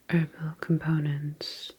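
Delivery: ordinary delivery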